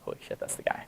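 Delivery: breathy hushed gasp of recognition; stunned whisper. Retold or imitated. Whispering to self on spotting the thief, stunned. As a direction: whisper it like you just spotted him.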